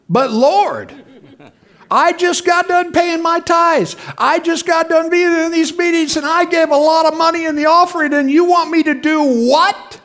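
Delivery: high, loud, pleading protest; protesting to the Lord. Retold or imitated. Preacher retelling his argument with God, high pleading protest. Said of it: "My brain is going".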